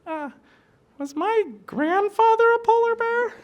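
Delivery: very high, slow little cub voice; baby polar bear. Acted. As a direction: ask it like a curious tiny cub. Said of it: "looks at mom and goes".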